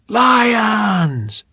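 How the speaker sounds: loud, high roar of a name; crowd shout. Acted. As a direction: bellow it back from the bleachers.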